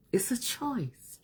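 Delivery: breathy whispered aside; slow, hushed whisper to herself. Real, not acted. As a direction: whisper it like a private reminder. Quiet aside on choosing happiness.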